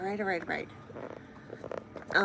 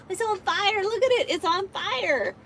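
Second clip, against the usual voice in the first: excited laughing; 9 semitones higher, 4 dB louder than usual. Real, not acted. Thrilled by big donation.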